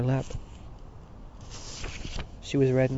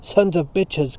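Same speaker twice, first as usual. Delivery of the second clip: loud, raised-pitch curse; angry cry; 5 semitones higher, 7 dB louder, slower than usual. A narrator's reading. Mister Dedalus cursing the priests at dinner, angry cry. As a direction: burst out cursing the traitors. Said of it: "cried Mister Dedalus".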